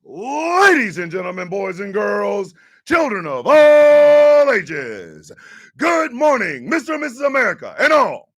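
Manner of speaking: booming ringmaster announcement, stretched out. Acted. Show intro announcer.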